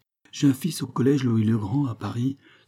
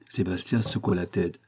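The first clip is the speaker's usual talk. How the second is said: plain reading voice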